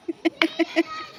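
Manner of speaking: sudden high-pitched giggle. Real, not acted. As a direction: burst into a squeaky laugh. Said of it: "अब तो मुझे भी हंसी आ रही है". (ऊँची खिलखिलाती हंसी)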